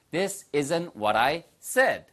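very slow, every word clear; this isn't what I ordered, drawn out for repeating; slow model sentence. Shown as a demonstration. Teacher modelling the sentence slowly for learners. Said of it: "뭘까요".

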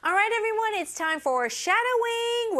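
high, energetic teacher announcement; upbeat rallying call. Real, not acted. Teacher calls everyone to shadowing practice, upbeat and rallying.

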